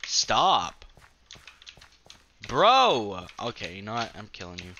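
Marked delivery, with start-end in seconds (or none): 0.1-3.3 annoyed shout. Real, not acted. Annoyed at mob attacking.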